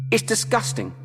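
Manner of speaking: high, revolted outcry; revolted cry. A narrator's reading. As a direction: cry out in disgust. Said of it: "she cried".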